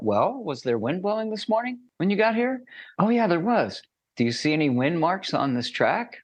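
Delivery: acted excited discovery. Acting out guide and learner dialogue.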